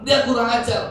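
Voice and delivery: high-pitched indignant rant, acted out; mock tirade, voice pushed way up; retold angry father. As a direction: imitate a father fuming at being disrespected. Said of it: "dia marah-marah".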